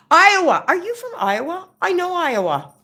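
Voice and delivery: light up at the name Iowa; excited. Real, not acted. Reading a viewer's state aloud.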